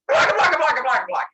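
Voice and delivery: jabber excitedly like a monster; monster gibberish. Retold or imitated. Monsters answering the offer to become free, loud gibberish. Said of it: "I don't speak monster".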